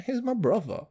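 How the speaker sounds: rough attempt at a Cockney accent; lightly put-on Cockney "brother". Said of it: "he fucking Cockney accent?".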